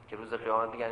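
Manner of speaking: ordinary delivery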